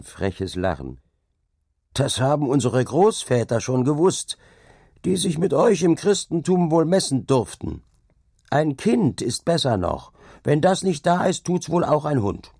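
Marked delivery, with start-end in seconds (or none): 1.9-12.5 high-pitched, brazen retort; insolent taunt. A narrator's reading. Carter defends sacrifice to the dike master, insolent taunt. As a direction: needle the master with old lore.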